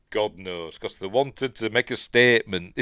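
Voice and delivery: ordinary talk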